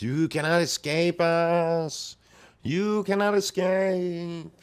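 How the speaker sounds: high, slow, loud eerie taunt; demonic voice. Acted. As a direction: stretch every word like a haunting spirit.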